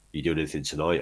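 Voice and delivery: ordinary delivery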